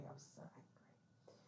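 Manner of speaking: whispered under the breath. Real, not acted. Retelling her anger about the editor, whispered. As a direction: breathe it out with no voice, still fuming.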